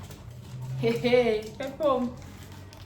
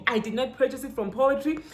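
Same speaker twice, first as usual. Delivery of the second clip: ordinary talk, nothing marked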